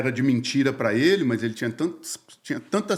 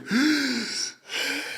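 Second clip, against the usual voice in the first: a sudden high gasp as relief hits; sharp gasp; 11 semitones higher than usual. Retold or imitated. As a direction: suck the air in fast and high. (suspiro súbito e agudo de alívio)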